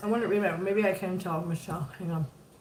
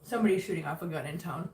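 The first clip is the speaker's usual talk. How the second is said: ordinary talk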